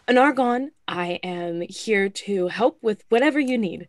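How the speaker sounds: standing at attention. Acted. Recruit at attention addressing Anargon, loud. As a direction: report for duty crisply. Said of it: "she's like".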